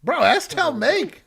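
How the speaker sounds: loud, excited outburst; worked up. Real, not acted. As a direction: shout it in laughing disbelief. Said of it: "I'm so pissed".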